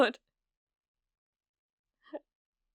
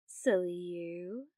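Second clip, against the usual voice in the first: slow teasing; 6 semitones lower, much slower than usual. Acted. Teasing the viewer.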